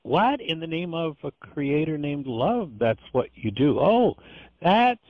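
high-pitched, astonished questioning; incredulous disbelief. Acted. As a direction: act out an appalled onlooker's shocked reaction. Acting onlookers' shocked reaction.

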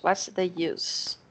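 "use" ending in a soft hissing S; use with an S sound. Shown as a demonstration. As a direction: say "use" slowly with an S. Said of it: "Se for nome".

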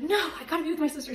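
high, loud, rapid protest; urgent refusal. Retold or imitated. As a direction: re-enact breaking away in a hurry. Retold breaking away from the man.

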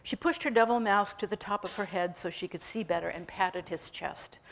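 ordinary delivery, nothing marked

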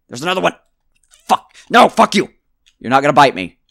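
loud, high panicked yelling; startled shouting. Real, not acted. Player startled by bugs, shouting loud and high. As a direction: yell at the bugs in a real fright.

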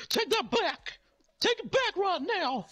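very high, strained manic demand; hammed-up furious clown in a shrieky falsetto; Ronald McDonald. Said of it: "a little manic".

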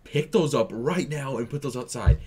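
re-enacted angry parental command; pissed-off parent. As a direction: order the kid sternly, fed up. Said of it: "getting fucking pissed at this point".

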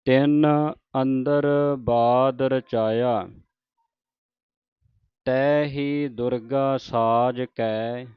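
drawn-out devotional reading, line by line; slow scripture recitation. Shown as a demonstration. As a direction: recite it slowly like Gurbani santhiya. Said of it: "ਕੈ ਤੇ ਵਿਸਰਾਮ ਹੈ". (ਹੌਲੀ ਹੌਲੀ ਪਾਠ ਦਾ ਉਚਾਰਨ)

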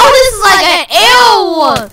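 loud laugh through the words; laughing shout. Real, not acted. Laughing at losing.

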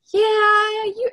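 high, loud mock mom voice; a teasing impression of mom; imitating mom. Daughter imitates mom teasing her, high and loud. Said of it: "my mom goes".

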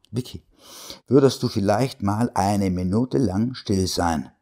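ordinary delivery

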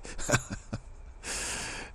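soft amused chuckle; real laugh. Real, not acted. Teacher amused that the mother did stay, soft laugh. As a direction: chuckle quietly at the outcome.

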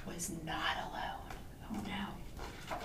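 put-on soft, ominous aside to children; suspenseful hush. A narrator's reading. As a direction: drop into a storyteller's whisper. Suspense in read-aloud story.

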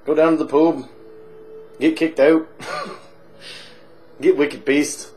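boozy Irish pub regular, chatty and cheeky; hammed-up Irish drunk telling a tale; stage Irish accent. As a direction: lay on a thick comic Irish brogue. Held Irish barfly voice.

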